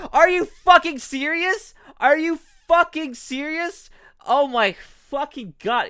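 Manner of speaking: real furious streamer; angry disbelief. Real, not acted. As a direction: hammer each word in real outrage. Raging at a short demo.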